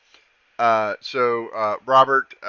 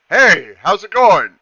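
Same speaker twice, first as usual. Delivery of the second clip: slow, friendly, loud hello; friendly mock greeting; 5 semitones higher, 10 dB louder, slower than usual. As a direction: greet them brightly and slowly. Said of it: "the guy goes".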